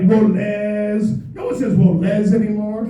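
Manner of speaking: ordinary talk, nothing marked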